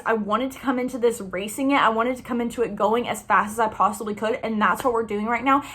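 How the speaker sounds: usual speaking voice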